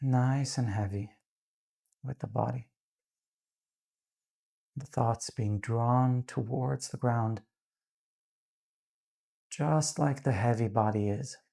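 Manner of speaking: calm, unhurried meditation voice with long pauses; guided meditation. Real, not acted. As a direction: gently guide them into grounding, leaving space between phrases.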